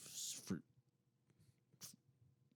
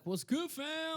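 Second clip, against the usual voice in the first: excited greeting; slower than usual. Acted. Greeting a patron on air.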